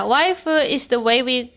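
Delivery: ordinary talk, nothing marked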